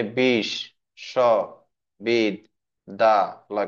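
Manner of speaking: five separate beats: বিশ, স, বিদ, দা, লয়; short gaps between বিশ, স, বিদ, দা, লয়; split into syllables. Shown as a demonstration. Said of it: "কয়টা সিলেবল হইলো".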